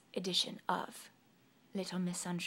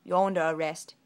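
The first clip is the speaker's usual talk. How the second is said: stern arrest; 2 semitones lower, 11 dB louder than usual. Acted. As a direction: lay down the law. Soldier arrests her.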